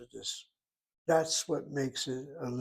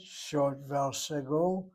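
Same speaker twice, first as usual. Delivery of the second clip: ordinary delivery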